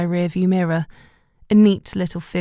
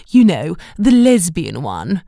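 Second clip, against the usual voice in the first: trumpeting; 7 dB louder than usual. Retold or imitated. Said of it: "Gladys would be trumpeting".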